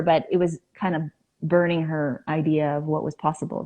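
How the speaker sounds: ordinary delivery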